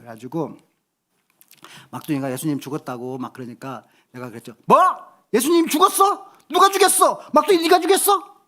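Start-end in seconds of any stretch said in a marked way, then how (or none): 4.7-8.4 loud, high, playfully accusing shout; mock interrogation shout. Dad teasing his youngest son in the car, loud mock interrogation. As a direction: grill them in rapid, raised-voice questions. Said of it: "막 큰 소리로 막".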